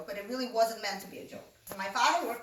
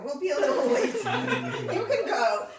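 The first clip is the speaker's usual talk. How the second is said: laughing; 5 semitones higher, 7 dB louder than usual. Retold or imitated. Mom answering, laughing.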